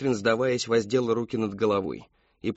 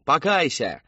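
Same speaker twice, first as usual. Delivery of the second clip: loud, higher-pitched command; demanding shout; 5 semitones higher, 5 dB louder, slower than usual. A narrator's reading. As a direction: call out as an accusing crowd. Congregation demands repentance.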